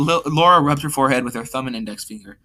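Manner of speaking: ordinary delivery, nothing marked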